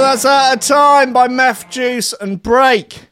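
hyped DJ back-announce. Real, not acted. Radio DJ hyped back-announcing a track.

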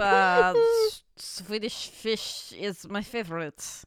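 put-on slow German accent posing as Swedish; mock German accent. German bluffing about being Swedish, mock German accent. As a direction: ham up a German trying to pass as Swedish.

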